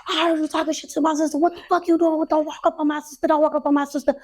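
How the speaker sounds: high, rapid-fire confrontation re-enacted; going crazy. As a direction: charge in ranting at a high pitch. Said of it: "I just come out going crazy".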